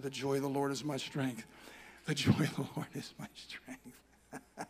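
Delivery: soft, low, slow self-encouragement; a hushed reminder, said slowly; quiet murmured prayer. Retold or imitated. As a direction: murmur it to yourself in the car. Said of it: "I said".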